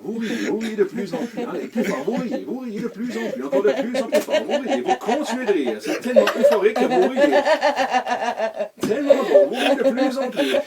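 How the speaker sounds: high, loud hypnotic commands; urgent loud urging. Acted. Hypnotist whips up the sleepers' laughter, loud urging.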